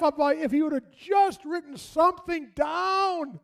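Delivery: very high, loud, hammed-up rant; imitated mad widow. Widow venting at husband who wrote nothing down, high-pitched mock fury. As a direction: pour out mock-furious exasperation at the top of the voice. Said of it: "she's mad".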